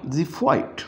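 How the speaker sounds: ज़िफ़ाइट said with the X as a Z sound. Shown as a demonstration. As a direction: start ज़िफ़ाइट with a Z. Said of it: "इसको pronounce करेंगे".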